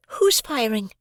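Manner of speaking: put-on high boy's voice, curious; a boy asking eagerly; boy Pip asks who is firing, high and curious; young boy Pip. A narrator's reading.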